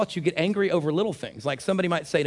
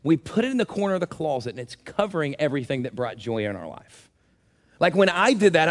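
usual speaking voice

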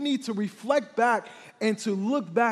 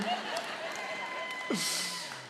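real laugh; 7 dB quieter than usual. Real, not acted.

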